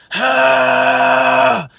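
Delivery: long, loud, steady rasp; held scream. Shown as a demonstration. Coach showing how to hold it, sustained scream. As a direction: hold the harsh exhale without wavering. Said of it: "you start to hold that".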